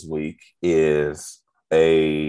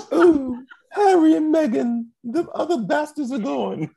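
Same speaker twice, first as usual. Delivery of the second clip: high, fake sobbing British queen; hammed-up weeping royal; mock crying queen.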